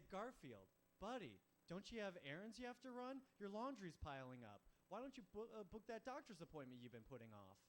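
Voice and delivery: coaxing nag with rising urgency; mock nagging. Owner to Garfield about Saturday chores, mock nagging.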